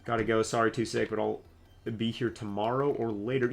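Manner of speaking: normal talking voice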